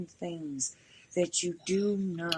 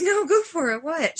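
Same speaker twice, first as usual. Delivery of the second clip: eager retold urging; 10 semitones higher, 8 dB louder than usual. Urging cashier to tell.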